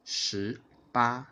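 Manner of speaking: ordinary delivery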